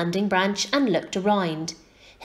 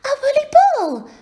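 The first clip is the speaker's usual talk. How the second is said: put-on very high, loud little-girl cry; excited little girl; 6 dB louder than usual. A narrator's reading. Little girl sees owl bounce to her feet, excited cry. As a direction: cry out high in delighted surprise. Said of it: "cried the little girl".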